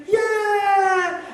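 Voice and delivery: high, loud cheering yell; crowd cheer. Retold or imitated. Kids answering the pastor's hype, mock cheer. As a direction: yell back like the whole crowd.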